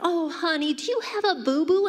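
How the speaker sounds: put-on high, sing-song baby talk; cooing at a hurt child. People cooing at a little girl's cut. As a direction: coo it like to a toddler with a scrape. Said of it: "they're like".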